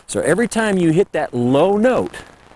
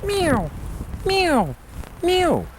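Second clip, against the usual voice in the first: high mew sliding down; high to low mew; 4 dB quieter, much slower than usual. Shown as a demonstration. Demonstrating elk mews to callers, high falling mew. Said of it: "it's this high to low thing".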